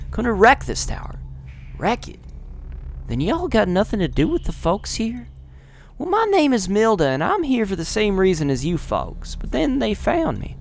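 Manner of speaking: put-on Milda explaining herself in a Southern accent. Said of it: "southern and whatever accent".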